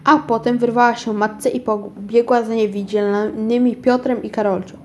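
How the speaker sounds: plain reading voice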